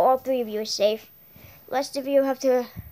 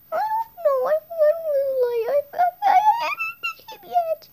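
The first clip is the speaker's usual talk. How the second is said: much higher whimpering small voice; high teary child voice, laughing through tears; crying little character; 14 semitones higher than usual. Acted. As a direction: sob it out like a tiny scared kid. Tiny character sobbing, high voice.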